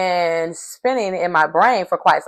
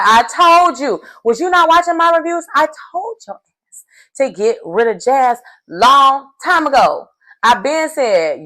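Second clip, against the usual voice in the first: told-you-so rant; 7 semitones higher, 3 dB louder than usual. Real, not acted. Vlogger scolds Harry, told-you-so.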